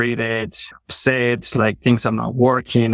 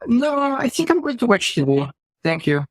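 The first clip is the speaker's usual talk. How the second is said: breezy, much higher mock-casual brush-off; cheeky refusal; 12 semitones higher than usual. Husband lightly brushing off his wife's chore request, mock-breezy. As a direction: toss off a lazy no with fake politeness.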